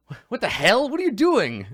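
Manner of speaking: loud, high-pitched disbelief; appalled knights. Acted. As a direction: call out in outraged bafflement at your boss. Knights react to boss's groveling.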